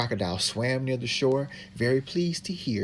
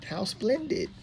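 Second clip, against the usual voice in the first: much higher, delighted exclamation; expressive model line; 10 semitones higher than usual. Shown as a demonstration. Helper demonstrating for the reader, bright delighted exclaim. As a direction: show the reader how to say it with wonder.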